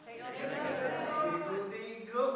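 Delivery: slow, loud, high naming; mother revealing the fairy's name, slow and loud; triumphant reveal. Acted. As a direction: savour every syllable in triumph.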